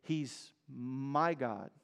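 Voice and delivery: "He's my God." said soft and slow, almost to yourself; personal, intimate. Shown as a demonstration. A believer speaking privately of God, soft and slow. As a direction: say it softly and slowly, as a private confession. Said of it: "To personalize it".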